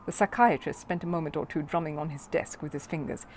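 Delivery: ordinary delivery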